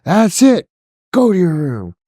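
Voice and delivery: mock angry yell. Retelling the dad yelling at his daughter, a mock angry yell. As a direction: blow up like a fed-up dad.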